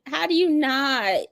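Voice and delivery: ordinary talk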